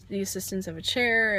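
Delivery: ordinary delivery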